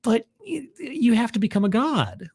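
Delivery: high, hesitant, stammering refusal; reluctant priest. Acted. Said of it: "And the guy's like".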